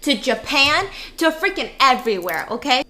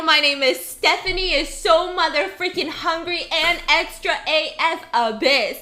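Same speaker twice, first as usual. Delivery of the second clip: ordinary talk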